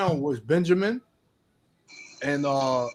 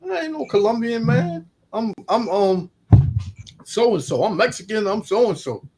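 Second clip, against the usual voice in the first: higher, indignant mock protest; mock offended reply; 7 semitones higher than usual. Asked if he's Colombian, a man snaps back: higher indignant voice. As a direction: bristle at being called Colombian.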